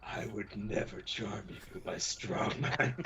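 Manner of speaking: slow, cooing seductive villain. Acted. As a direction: purr it out slowly. The villain mock-seduces Arlington.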